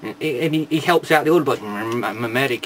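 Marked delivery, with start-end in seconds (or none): 1.6-2.6 low, soft mock robot medic; Pipes. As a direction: mumble it as a hapless medic. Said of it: "he goes".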